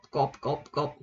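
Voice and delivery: cột said low and soft, over and over; teacher drills cột quietly, low and repeated; repeating the syllable. Shown as a demonstration. Teacher drilling the syllable for viewers: low, soft repeats. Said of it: "Mình sẽ đọc là cột".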